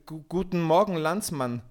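trying to sound brave but trembling; stammering scared. A narrator's reading. Peter greets the forest king, stammering in fear. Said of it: "aber heftig zitterte".

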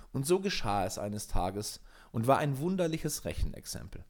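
ordinary delivery, nothing marked

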